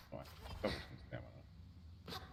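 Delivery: breathy whisper to self. Shown as a demonstration. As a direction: whisper it under your breath. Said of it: "repeats the words more softly".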